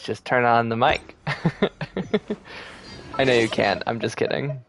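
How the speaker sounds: normal talking voice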